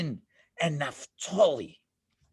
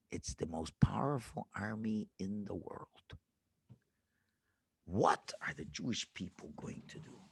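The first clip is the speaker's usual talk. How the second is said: low, soft, hushed voice sinking to a whisper; hushed suspense; 11 semitones lower, 7 dB quieter than usual. A narrator's reading. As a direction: draw the kids in with a scary hush. Suspense, looking around at dangers.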